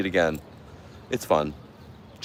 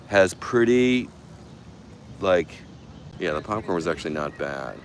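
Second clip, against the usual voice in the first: ordinary talk, nothing marked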